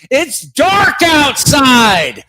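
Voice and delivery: ranting to the hosts about a stolen sunset; mock furious shout. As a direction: rage at the dark sky. Staged rant about daylight savings.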